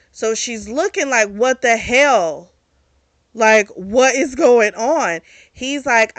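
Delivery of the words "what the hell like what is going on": shocked disbelief. Retold or imitated. Acted shocked reaction to villain.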